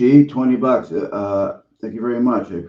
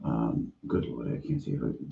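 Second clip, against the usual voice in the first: muttering; 11 semitones lower, 12 dB quieter than usual. Real, not acted. Muttering to self.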